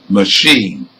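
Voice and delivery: "Machine" stressed on CHINE, weak first syllable; second-syllable stress. Shown as a demonstration. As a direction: lean on the end of "Machine". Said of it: "stress is on the second".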